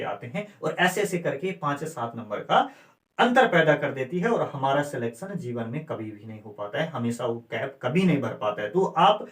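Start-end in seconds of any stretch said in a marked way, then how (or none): 4.3-8.7 गंभीर चेतावनी. Real, not acted.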